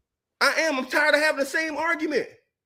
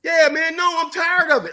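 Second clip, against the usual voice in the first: usual speaking voice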